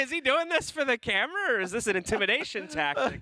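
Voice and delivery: high, amused, half laughing; laughing disbelief. Real, not acted. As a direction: ask it through giggles, can't believe it. Cracked up at player's stare.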